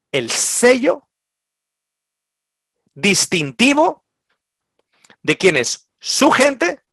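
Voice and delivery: el sello distintivo lifted high and slowed; high emphatic stress. Acted.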